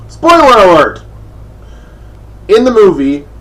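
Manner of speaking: high, loud called-out alert; spoiler alert. Shown as a demonstration. Reviewer alerts viewers before revealing the plot, loud call.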